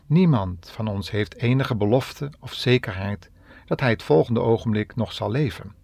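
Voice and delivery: ordinary talk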